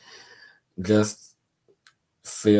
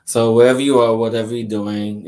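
much louder, calling everyone in; 3 semitones higher, 10 dB louder, faster than usual. Real, not acted. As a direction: call out to the whole audience. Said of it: "repeats the call, much louder".

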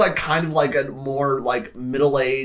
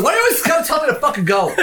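high, fast, louder mock protest; mock indignation; 13 semitones higher, 5 dB louder, much faster than usual. Podcaster quotes the older guy's line, indignant. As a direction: imitate an affronted man, laughing through it.

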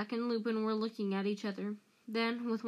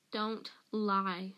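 usual speaking voice

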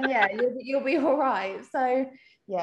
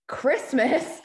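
incredulous echo, a bit higher; taken aback, questioning; retold disbelief; 4 semitones higher, 4 dB louder than usual. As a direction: repeat it back in mock shock. Retold shock at delivery date.

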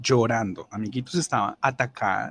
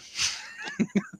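burst of laughter; 6 dB quieter than usual. Real, not acted. Laughing at the manager's tone.

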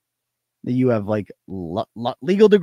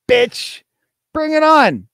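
taunting; 5 semitones higher, 4 dB louder, slower than usual. Real, not acted. Taunting the caller's wife.

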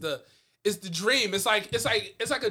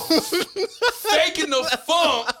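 laughing; 9 semitones higher, 6 dB louder, much slower than usual. Real, not acted. Laughing about the fake accent.

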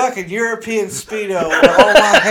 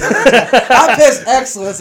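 louder, higher mock boast; quoting his friend; 4 semitones higher, 5 dB louder, much slower than usual. Friend's brag quoted to his buddy, slow mock boast. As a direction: say it slow and cocky like a proud show-off. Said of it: "That's exactly what he says".

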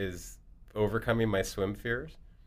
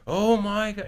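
exaggerated disbelief; astonished; 13 semitones higher, 9 dB louder than usual. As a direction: replay stunned delight, pitched way up. Acts out reaction to steroid question.

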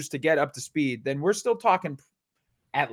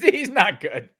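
laughing; 6 semitones higher, 3 dB louder, slower than usual. Real, not acted. Laughs at his own reversal.

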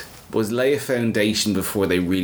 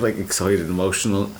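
ordinary talk

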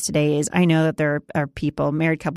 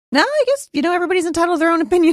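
high, fast, breezy shrug; breezy brush-off; 12 semitones higher, 5 dB louder, much faster than usual. Retold or imitated. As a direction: rattle it off lightly, much higher. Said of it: "And then I was like".

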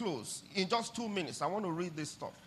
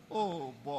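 normal talking voice

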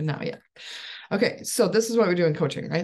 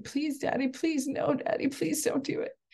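put-on pleading child; 10 semitones higher, 3 dB quieter than usual. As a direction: imitate a child begging Daddy not to. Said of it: "my child yell and scream and be like".

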